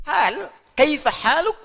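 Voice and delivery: "Hal, kaifa haluk" drawn out slowly as fasih Arabic; formal Arabic, slowly. Shown as a demonstration. Teacher slowly models the formal Arabic question to the class.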